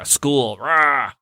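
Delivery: wordless grumbly roar around one word; mock grouchy growl; cranky growling. Venting to wife about school, cranky mock growl. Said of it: "I was cranky A F".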